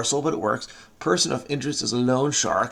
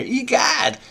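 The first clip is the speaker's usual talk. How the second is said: very high exclaimed egad; mock astonishment; 12 semitones higher than usual. Solver reading an exclamation answer, mock-astonished high cry. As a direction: cry out in hammed-up shock.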